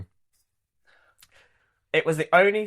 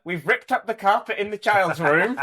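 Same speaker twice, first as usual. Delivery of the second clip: higher, louder upbeat retelling; cheerful message; 9 semitones higher, 6 dB louder than usual. Retold or imitated. Wife's message about decorating the house, acted cheerfully. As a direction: brightly report a job well done.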